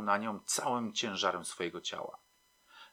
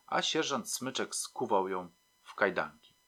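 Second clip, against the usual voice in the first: ordinary delivery, nothing marked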